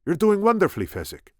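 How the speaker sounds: high, loud praise, dropping at the name; encouraging Spaniard. A narrator's reading. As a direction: cheer the climber on as the Spaniard. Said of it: "said the Spaniard".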